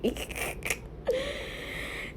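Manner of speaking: soft, rising giggle; breathy giggle. Real, not acted. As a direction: giggle after apologizing. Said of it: "I'm sorry".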